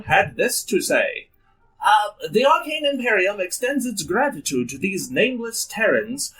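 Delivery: put-on grand old ballroom announcer; old-timey announcer. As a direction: boom it like vintage radio host. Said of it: "Go to the old-timey announcer".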